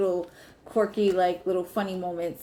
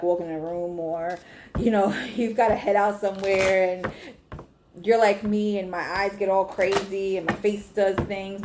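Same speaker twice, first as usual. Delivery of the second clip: usual speaking voice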